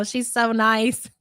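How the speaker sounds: ordinary talk, nothing marked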